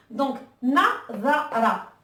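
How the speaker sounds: nazara, each syllable slow; Arabic word read slowly; slow model word. Shown as a demonstration. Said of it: "on voit qu'il y a le son A".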